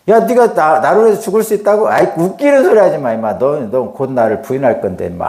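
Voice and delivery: a scornful teacher mocking his student's boast; mocking scold. Shown as a demonstration. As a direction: sneer at the boastful disciple. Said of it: "하면서 제자를 책망하는 말입니까? 이거 아닙니다".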